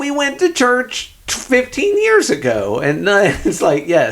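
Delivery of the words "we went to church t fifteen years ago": high, indignant mock-parent retort; parent contradicting. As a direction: imitate an exasperated parent objecting. Voicing parents contradicting their child.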